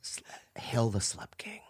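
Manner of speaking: hushed, voiceless fan's whisper. Retold or imitated. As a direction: slip it out as a secret whisper. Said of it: "walk by me and whisper".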